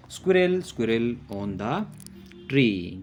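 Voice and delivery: slow, sing-song rhyme reading; poem recitation. Shown as a demonstration. Teacher recites a little poem slowly to children. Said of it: "poem है, उसको हम पढ़ लेते हैं".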